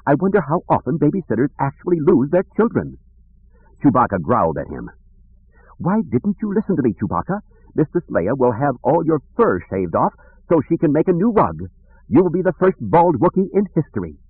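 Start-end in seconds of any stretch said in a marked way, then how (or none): 0.1-3.0 high fretful protocol droid; fussy droid voice. Acted. C-3PO frets aloud. 5.8-14.2 high prim droid scolding. Acted. C-3PO scolds Chewbacca.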